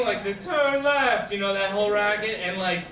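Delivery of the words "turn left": loud, high mock sat-nav command; talking navigator. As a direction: imitate a bossy navigation voice, pushed high. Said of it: "it's like the talking thing".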